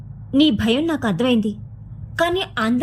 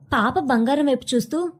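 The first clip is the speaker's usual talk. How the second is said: plain reading voice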